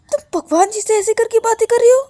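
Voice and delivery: high, loud incredulous question; shocked scolding. Acted. Girl appalled at how her friend addresses God, shocked scolding.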